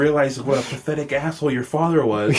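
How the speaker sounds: hammed-up tirade hurled at a student; mock yell.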